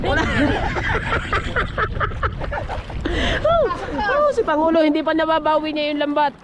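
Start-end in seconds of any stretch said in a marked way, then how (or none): 0.3-3.4 long, high wheezy laughter; laughing. Real, not acted. Fisherman joking with mates, long high laugh.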